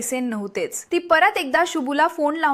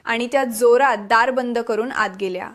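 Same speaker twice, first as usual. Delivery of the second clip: plain reading voice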